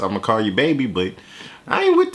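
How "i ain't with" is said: squeaky high yelp. Real, not acted. Reacting to rapper's line.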